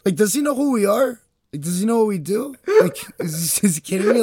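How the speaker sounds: high-pitched, incredulous questions; mock indignation. Voicing the bears about the man, mock indignation. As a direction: play offended bears in disbelief.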